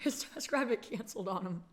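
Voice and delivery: soft, laughing under the breath; quiet giggling retell. Real, not acted. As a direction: repeat it softly through a real laugh. Repeats the line, laughing.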